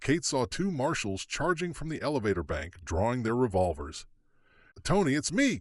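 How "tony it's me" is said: relieved yell. Acted. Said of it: "Kate yelled with relief".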